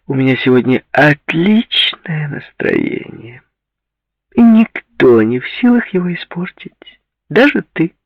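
high, airy woman's voice, slow to start; unbothered, self-satisfied high voice; nonchalant. A narrator's reading. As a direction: declare it coolly in a high, lilting voice. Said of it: "Затем невозмутимо произнесла".

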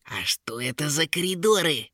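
high creature voice, rising question; curious imps. A narrator's reading. Curious imps ask about the corridors, high inquisitive voice. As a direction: ask like an inquisitive little imp. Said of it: "спросили любопытные анчутки".

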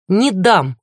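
firm resolute no; firmer, decisive refusal. A narrator's reading. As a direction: refuse with growing firmness. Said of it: "голос её звучал твёрже и решительнее".

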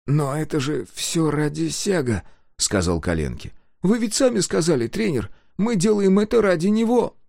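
put-on high earnest child voice; earnest boy. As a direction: argue like a pleading kid. Kolenki argues with coach.

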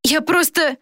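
put-on high, cut-short boyish plea; pleading boy. A narrator's reading. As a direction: start explaining anxiously in a high boy's voice. Boy pleading with captain in story. (высокий оправдывающийся голос мальчика)

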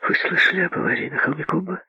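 breathy eager question; breathless. Acted. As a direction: ask it on a breath. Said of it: "с придыханием спросила она".

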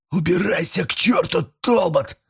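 half moan, half yell, pitched high; moaning shout. A narrator's reading. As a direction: cry it out between moan and shout. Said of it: "То ли простонал, то ли крикнул".

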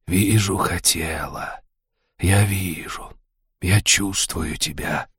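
soft, slow serpent voice; rustling snake voice. Acted. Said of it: "шелестящий голос".

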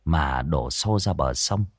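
lively village ghost-story telling; storyteller voice for a spooky folk tale; story narration. A narrator's reading. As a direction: draw the listener into the scene.

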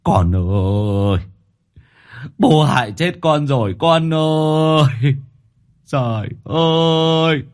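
a father keening over his dead son; wailing sob. A narrator's reading. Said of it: "khóc lên thảm thiết". (khóc than thảm thiết, kéo dài)

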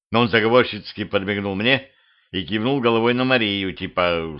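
plain reading voice

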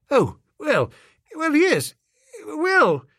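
high, squeaky flustered stalling; panicking, stalling for time; flustered stammer. A narrator's reading.

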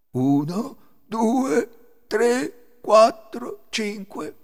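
loud, high, deliberate count; thief counting. A narrator's reading. Thief resuming the count of his money, loud measured count. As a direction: count out each number loudly. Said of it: "riprese a contare".

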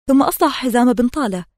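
plain reading voice

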